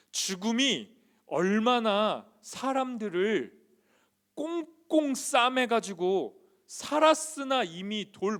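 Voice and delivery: high, loud sermon surge; impassioned preaching. Real, not acted. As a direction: preach it forcefully, driving each phrase. Preaches at full force.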